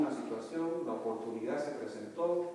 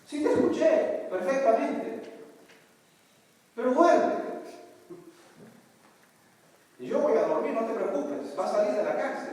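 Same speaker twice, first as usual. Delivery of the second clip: loud calm reassurance; 10 semitones higher, 8 dB louder than usual. Retold or imitated. Pastor answers the panicked wife.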